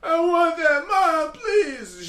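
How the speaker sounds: put-on high, whiny child plea; crying kid begging. Little boy pleading with his mom for a toy, high and whiny. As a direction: beg like a tearful little boy.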